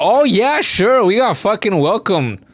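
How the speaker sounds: high mock-cheerful sarcasm; sarcastic. Real, not acted. Gamer answering the game's fake hospitality, sarcastic. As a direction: throw the welcome back with mock delight.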